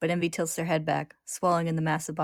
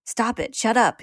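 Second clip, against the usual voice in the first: high, desperate yell, getting louder; 6 semitones higher, 6 dB louder, slower than usual. A narrator's reading. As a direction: shout down voices you can't bear. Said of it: "he yells, trying to cover".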